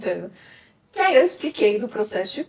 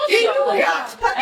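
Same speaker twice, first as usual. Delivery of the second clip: mock exclamation; 13 semitones higher, much slower than usual. Real, not acted. Teasing that everyone has done it.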